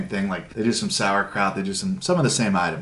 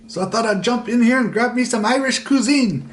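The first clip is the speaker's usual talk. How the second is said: hammed-up Irish accent, pitched much higher; self-mocking over-the-top Irish accent; horrible Irish accent; 10 semitones higher than usual. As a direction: keep up the bad stage-Irish voice. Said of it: "this horrible Irish accent".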